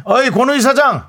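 calling out to boss. Retold or imitated. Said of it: "그렇게 불러주는 거죠".

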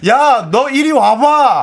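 loud slow laughing Busan threat; laughing tough guy calling a rival over; mock Busan challenge. Acting Busan quarrel call.

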